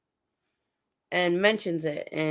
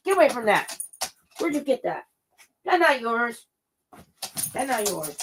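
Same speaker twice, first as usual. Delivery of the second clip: higher-pitched telling-off for naughty dogs; exasperated, falling dog-owner reproach; scolding; 5 semitones higher than usual. Real, not acted. Scolding dogs, surveying chewed gloves.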